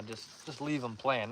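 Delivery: ordinary talk, nothing marked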